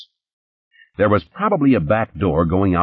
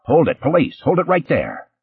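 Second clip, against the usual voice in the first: shouting; 9 semitones higher than usual. Acted. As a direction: bark it as a cop storming in.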